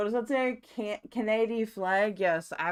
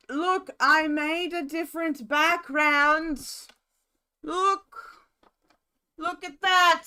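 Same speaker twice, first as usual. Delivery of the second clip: excited showing off; 7 semitones higher, 4 dB louder than usual. Real, not acted. Showing off a new background.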